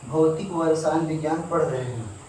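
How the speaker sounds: ordinary talk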